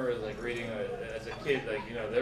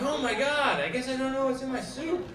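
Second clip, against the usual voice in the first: high, anxious hooked viewer; mock worry; 8 semitones higher than usual. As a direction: play the gullible worried viewer, pitched high. Hooked viewer reacts to teaser.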